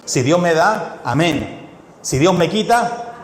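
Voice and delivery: ordinary delivery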